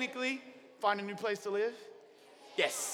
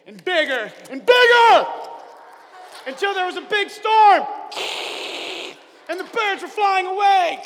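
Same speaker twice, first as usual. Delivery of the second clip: storm building; 8 semitones higher, 11 dB louder than usual. A narrator's reading. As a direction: voice swelling into a loud shout. Storm rising in the story.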